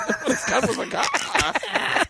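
drawn out through laughter; laughing echo of the joke; laughing repeat. Real, not acted. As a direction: stretch it slowly while cracking up.

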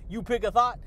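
normal talking voice

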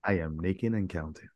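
low, soft, mock-seductive show title; sultry voice. Host redoing the show opener, mock-sultry. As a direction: murmur the title with a put-on sexy tone. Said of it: "sultry voice".

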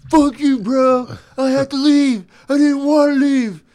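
high, loud yelling bro; angry shout. Acted. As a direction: scream it back at your buddy. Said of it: "a B F F quarrel".

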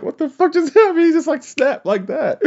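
high, stunned outburst; shocked disbelief. Retold or imitated. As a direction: blurt it out in shock. Retelling shocked reaction.

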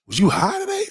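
high-pitched, incredulous mock scold; mock scolding manager. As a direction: squeak it out like a disbelieving manager. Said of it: "I'm like".

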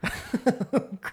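airy burst of laughter; breathy laugh. Real, not acted. Laughs at Mike jab.

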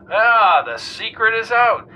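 jaunty talk-radio host. A narrator's reading. Arlo Wombat quizzing the weatherman on air, breezy host.

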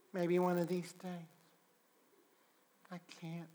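fading to a near whisper, hesitant; a timid excuse trailing off; reluctant and hushed. Acted. Believer excusing himself to God, hushed and hesitant.

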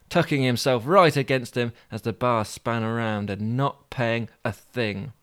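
ordinary delivery, nothing marked

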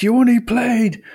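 high-pitched mock outrage at the ref; retold coach's scream, not at full volume; imitated screaming. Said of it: "getting screamed at by the opposition coaches".

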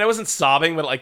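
normal talking voice

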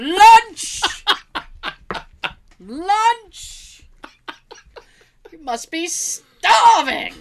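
high, loud, slow lunch call; mock shouting meme. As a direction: bellow the meme line.

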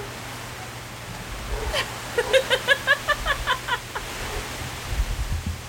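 high, wheezy, breathless giggling; real laughing fit that keeps going; long helpless laugh. Real, not acted. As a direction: crack up helplessly, out of voice. Helpless laughter at derailed recording.

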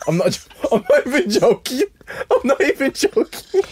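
fail to keep a straight face; laughing. Real, not acted. Insisting to friends he means it about the awards, laughing. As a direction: protest through helpless laughter, voice climbing high. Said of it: "So why are you laughing?".

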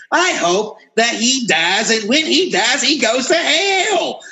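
hammed-up damnation, climbing to the last word; mock-furious curse. Ranting curse, then called a joke.

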